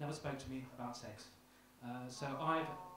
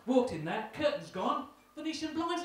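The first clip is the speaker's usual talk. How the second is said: higher and louder, outraged disbelief; indignant reveal; 8 semitones higher, 8 dB louder than usual. Acted. Son finding curtains replaced, higher louder mock outrage. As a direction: announce the discovery with mock outrage.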